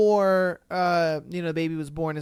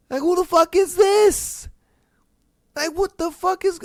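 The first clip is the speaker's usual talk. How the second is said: high, loud, disbelieving shout; a real angry shout; outraged shout; 10 semitones higher, 5 dB louder than usual. Real, not acted. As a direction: yell it in outrage at the mistress. Outraged at the mistress.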